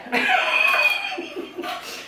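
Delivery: very high burst of laughter; embarrassed laugh. Real, not acted. Cook laughing about her hot flashes, very high. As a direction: squeal of a laugh at yourself. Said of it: "I'm getting them again".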